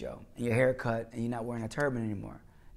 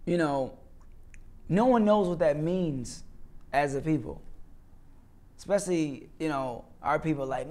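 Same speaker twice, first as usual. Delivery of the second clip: ordinary delivery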